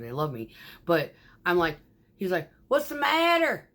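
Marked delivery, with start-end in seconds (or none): 2.7-3.7 quoted question. Retold or imitated. Voicing the finishing guy.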